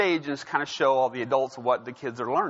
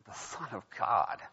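much softer, lower scornful aside; disdainful muttering; 5 semitones lower, 8 dB quieter than usual. Retold or imitated. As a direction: mutter it like a smug Pharisee. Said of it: "he's like".

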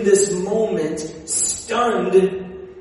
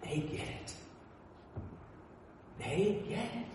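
hushed; 2 semitones higher, 19 dB quieter than usual. Real, not acted. Quiet realization after fan story.

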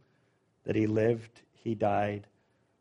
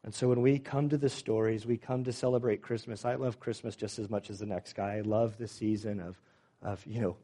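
normal talking voice

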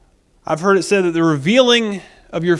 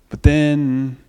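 ordinary talk

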